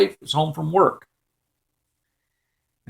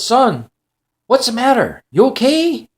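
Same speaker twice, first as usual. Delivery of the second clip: raised pitch, lightly voiced worried father; concerned dad; 7 semitones higher than usual. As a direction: ask with gentle urgency, voice lifted. Dad checks on screaming son.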